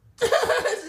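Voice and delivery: big high laugh; loud burst of laughter. Real, not acted. Laughing at friend.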